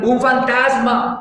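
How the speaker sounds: high, drawn-out mock cry of fright; mock scared cry. Preacher acting the disciples' frightened cry to the congregation. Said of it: "gritando de miedo".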